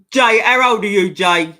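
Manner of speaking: loud call across the room. Real, not acted. Streamer calling out to a chat member, loudly. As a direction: shout the question at Jay.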